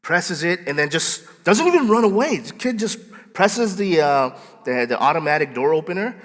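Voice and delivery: normal talking voice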